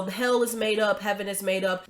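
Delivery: ordinary talk, nothing marked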